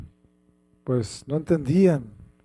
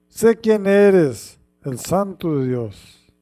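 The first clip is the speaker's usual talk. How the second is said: louder, emphatic scripture reading; lectura bíblica enfática; 7 dB louder than usual. Acted. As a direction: read it out with dramatic weight. (lectura enfática y dramática)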